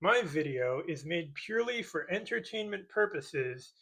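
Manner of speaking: ordinary delivery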